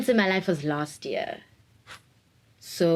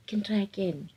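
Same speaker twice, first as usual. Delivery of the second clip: breathy whisper, softer; whispered aside; 5 dB quieter than usual. Real, not acted. Whispering to the viewer that you can try again. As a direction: let it out as a small secret.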